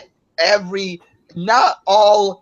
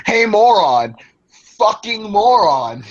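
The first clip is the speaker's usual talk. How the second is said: mock yelling insult, louder and higher; imitating a yell; 5 semitones higher, 4 dB louder than usual. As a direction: ham up a ranting YouTuber's shout. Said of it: "He's yelling in his videos every five seconds".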